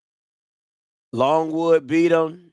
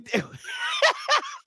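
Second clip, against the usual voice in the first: high laugh; 5 dB quieter than usual. Real, not acted. Laughing mid-sentence.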